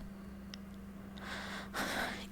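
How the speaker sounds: long breathy exhale; drawn-out voiceless sigh. Acted. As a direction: sigh it out, giving up. Giving up on finding answers.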